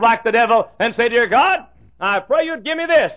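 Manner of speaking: high, loud revival preaching; full-force preaching. Acted. Preacher rousing the congregation, in loud high preaching.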